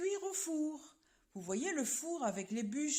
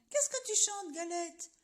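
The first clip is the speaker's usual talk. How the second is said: sly fox asking; 5 semitones higher than usual. Acted. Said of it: "Et il demande".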